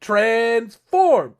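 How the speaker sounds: loud high mock battle cry; shouted transform. Friend acting out Greg yelling as he puts on mask, loud. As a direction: yell it like a toy hero. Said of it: "and he's like".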